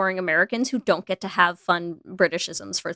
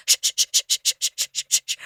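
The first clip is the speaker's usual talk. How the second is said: soothing shushing. Retold or imitated. Imitating a raccoon soothing the cat with a shush. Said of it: "the raccoon just goes".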